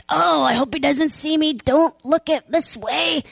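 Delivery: hiding Peter. Retold or imitated. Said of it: "if I'm Peter".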